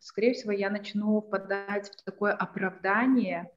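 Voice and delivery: ordinary talk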